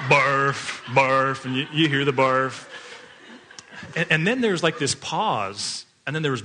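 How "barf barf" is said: imitated retching. Imitating son vomiting.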